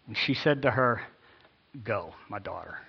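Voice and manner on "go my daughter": low and soft, falling away; resigned reading. Acted. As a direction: read it low, subdued and unenthusiastic. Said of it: "how this is supposed to be read".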